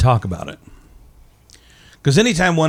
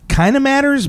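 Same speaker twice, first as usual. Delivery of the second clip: high squeak; 4 dB louder than usual. Acted. Joking about size.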